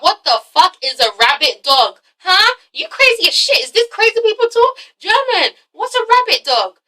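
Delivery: high-pitched incredulous questioning; baffled disbelief. Retold or imitated. Viewer questioning German about his odd insult, mock baffled. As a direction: demand an explanation, mock bewildered.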